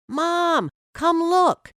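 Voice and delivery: high, loud eager call; excited call. A narrator's reading. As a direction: yell for mom to come see the flower. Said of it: "he called".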